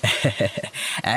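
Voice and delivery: soft, rapid chuckle; pleased chuckle. Acted. Husband delighted with his wife's plan, chuckling. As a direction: chuckle softly in approval.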